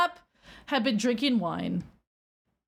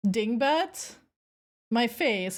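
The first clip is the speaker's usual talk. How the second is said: usual speaking voice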